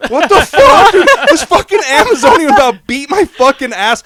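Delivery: high, loud storyteller's outburst; hammed-up incredulous shout; outraged disbelief. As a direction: yell it in comic outrage. Retelling his outraged reaction to friends.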